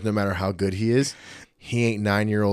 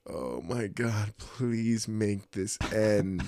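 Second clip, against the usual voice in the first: very slow, softer pleading groan; suffering inner plea; 7 dB quieter, much slower than usual. Retold or imitated. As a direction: drag it out in misery. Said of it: "And I'm like".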